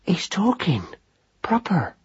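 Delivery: soft breathy whisper, Proper fully unvoiced. A narrator's reading. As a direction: hush it like a secret. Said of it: "whispered Madeline at last".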